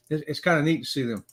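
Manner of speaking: ordinary talk, nothing marked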